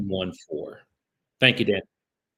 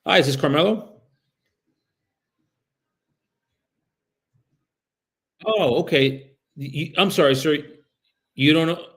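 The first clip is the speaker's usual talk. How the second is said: bright, higher and louder phone greeting; polite phone voice; 5 semitones higher, 6 dB louder than usual. Real, not acted. Caller greets an annoyed property owner, polite and apologetic. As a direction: sound friendly and apologetic to a stranger. Live cold call to a stranger.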